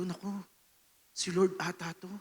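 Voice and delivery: soft, awed murmur; hushed awe. Retold or imitated. Drummer sensing the Lord walking near, hushed awe. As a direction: breathe it out in quiet wonder. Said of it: "So, sabi ko".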